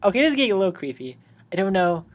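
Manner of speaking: rushed, uneasy aside; fast nervous aside. Real, not acted. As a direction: rattle it off nervously. Own reaction, fast.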